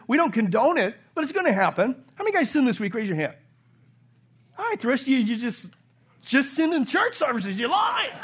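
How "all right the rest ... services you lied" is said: teasing. Real, not acted. Teasing congregation who didn't raise hands.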